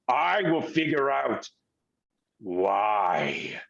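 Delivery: low, deliberate angry villain; pissed-off villain. Acted. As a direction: vow it word by word with contained anger. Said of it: "the guy definitely sounds pissed".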